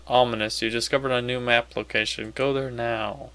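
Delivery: normal talking voice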